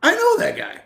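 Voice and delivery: high, amazed mock exclamation; high-pitched imitation of thrilled surprise; amazed disbelief. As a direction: sound starstruck seeing a friend on TV. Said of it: "turning on the telecast and going".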